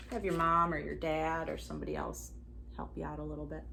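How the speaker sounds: ordinary delivery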